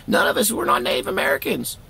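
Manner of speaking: high, chatty uncle explaining results; uncle's voice. Retold or imitated. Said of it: "he's like".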